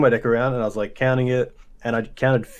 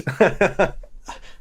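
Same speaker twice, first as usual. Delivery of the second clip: high, airy burst of laughter; 8 semitones higher than usual. Real, not acted. Player amused by his own game-loss story, laughing. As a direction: break up laughing.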